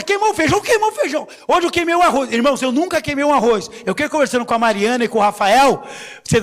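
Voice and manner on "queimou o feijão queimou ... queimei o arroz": higher, whiny mock excuse. Preacher mocking church members' excuses in a high whiny voice. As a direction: parody someone who always has an excuse.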